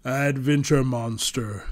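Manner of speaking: fond, slow, smiling voice; warm smiling greeting. A narrator's reading. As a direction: greet an old friend warmly. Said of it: "smiled as he said the monster's name".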